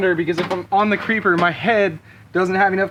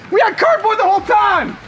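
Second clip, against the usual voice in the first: louder, higher, laughing hard; louder burst of laughter; 11 semitones higher, 5 dB louder than usual. Real, not acted. Repeats the line, louder.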